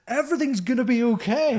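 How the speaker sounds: high, elated mock cry; retold exclamation. Musician retelling his reaction to the queue, mock-elated cry. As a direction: call it out high, imitating your earlier self. Mimics own relieved exclamation in story.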